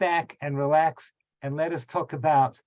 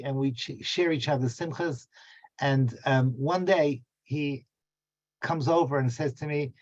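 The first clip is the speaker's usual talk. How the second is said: normal talking voice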